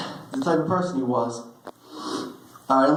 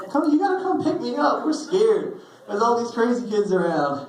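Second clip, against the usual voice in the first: put-on high, pleading voice of a scared teen; mock panic, pitched up; scared kid calling; 9 semitones higher than usual. Teen begging grandma on the phone, high and panicked. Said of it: "I remember calling my grandma".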